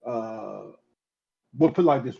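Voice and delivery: normal talking voice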